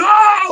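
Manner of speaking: high-pitched breathless war cry; imitated charging yell. Going after these guys while losing air, strained yell.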